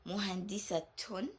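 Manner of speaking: "muhandisatun" with the ta clearly sounded; Arabic model word. Shown as a demonstration. Teacher showing how the ta is pronounced. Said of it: "we pronounce this as".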